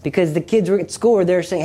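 usual speaking voice

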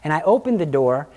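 ordinary talk, nothing marked

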